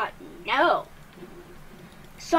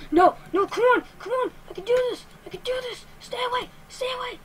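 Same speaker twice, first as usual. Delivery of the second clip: high, urgent squeaky pleading; panicked; 10 semitones higher, 4 dB louder, faster than usual. Real, not acted. Player begging a pursuer to stay away, panicked. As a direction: panic in short, repeated high bursts.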